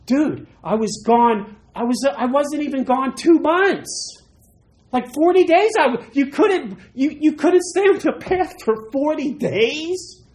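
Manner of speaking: hammed-up exasperation at the brother; outraged Moses. Moses returning to find the golden calf, loud disbelief. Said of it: "I'd be like".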